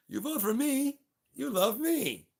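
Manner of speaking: high, loud, boastful; mock Putin. Putin telling voters to pick him, in a loud mock voice. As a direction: puff up like a smug dictator. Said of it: "Vladimir Putin, "Oh".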